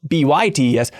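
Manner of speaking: B Y T E S said as separate letters; spelled letter by letter. Shown as a demonstration. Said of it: "spelled out".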